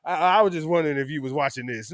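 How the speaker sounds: a friend pretending sympathy over the bad game; teasing friend. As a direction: rub it in gently.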